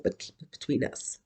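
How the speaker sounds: hushed, conspiratorial whisper, no voice; secretive whisper. Retold or imitated. Speaker to the closet rebels in the room, whispered secret. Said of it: "It's like saying".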